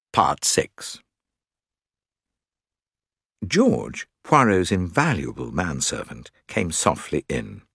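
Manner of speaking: plain reading voice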